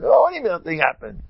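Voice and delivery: high, fast challenge; mock incredulous retort. Preacher challenging the toilet-goer's denial, mock incredulous. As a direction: throw the denial back, high and quick.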